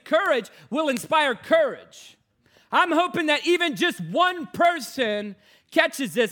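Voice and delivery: preaching. Acted. Preaching to congregation.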